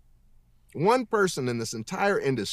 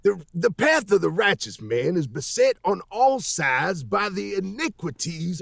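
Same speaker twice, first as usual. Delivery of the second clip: cowboy voice; 6 semitones higher than usual. Retold or imitated. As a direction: preach it like a Texas cowboy. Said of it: "I did that in a cowboy voice".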